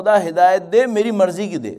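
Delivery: mock selfish prayer. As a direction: parody someone telling God what to give. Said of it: "اب میں خدا سے کہہ رہا ہوں".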